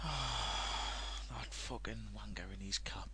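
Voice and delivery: slow unvoiced whispered aside. Real, not acted. Cursing a player under his breath, slow whisper. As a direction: hiss it under your breath, slowly.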